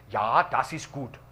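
slow, harsh fake German; lightly put-on German accent; mock German phrase said slowly. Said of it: "it sounded harsh".

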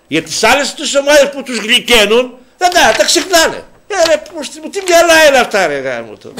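high-pitched, loud indignant rant; worked-up exasperation. Real, not acted. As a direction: voice climbing high in disbelief. Ranting at selective memory of others.

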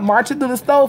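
normal talking voice

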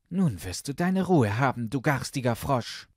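angry princess shout, garstiger spat out; furious princess. Acted. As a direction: hurl the words like the frog against the wall. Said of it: "Da ward sie erst bitterböse".

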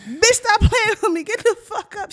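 high, very loud demand; urgent shouting. Retold or imitated. Storyteller trying to wake fainted friend, urgent shouting. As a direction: yell at her to snap out of it.

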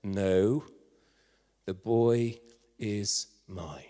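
slow, low, deliberate claim; measured authoritative declaration; quiet authority. Acted. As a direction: state it slowly and low, with spaces between the words. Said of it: "quite authoritatively said".

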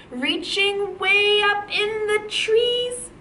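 slow, high sing-song climb; drawn-out, rising singing tone; reaching upward. Shown as a demonstration. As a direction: let the voice climb into the treetops. Teacher models reaching up high.

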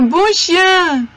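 high, bright doggy praise; praising a dog. Real, not acted.

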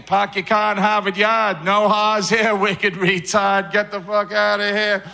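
put-on loud, brash Boston accent with dropped R's. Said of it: "maybe we should go to Boston".

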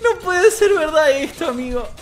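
high, thin voice squeezed by laughter; tearful laughing disbelief. Real, not acted. Streamer who can't believe the match, tearful laughing disbelief. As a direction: barely get it out through laughter. Overcome by the game again.

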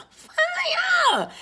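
very high, drawn-out shriek of FIRE; playful scream. Pretend. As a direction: squeal it out long and high. Playful scream of the card's word.

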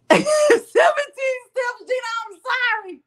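high, slow words squeezed out through laughter; can barely get it out for laughing; laughing hard. Real, not acted. Reading chat, cracking up.

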